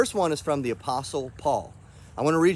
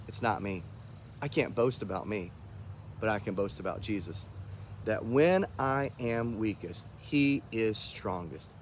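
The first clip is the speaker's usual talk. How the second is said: sincere; 6 semitones lower, 7 dB quieter than usual. Real, not acted. Humble confession of faith.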